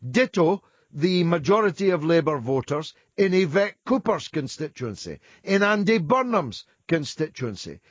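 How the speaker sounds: loud, forceful listing; emphatic. Real, not acted. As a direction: hammer each point home. Host heatedly listing constituencies.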